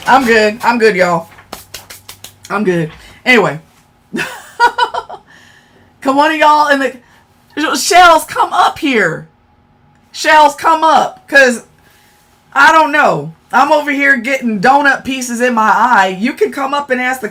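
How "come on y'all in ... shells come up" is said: drawn-out, higher-pitched call. Real, not acted. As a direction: summon someone up, stretching the words out. Calling a viewer up on stream.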